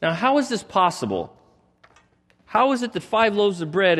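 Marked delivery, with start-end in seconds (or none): none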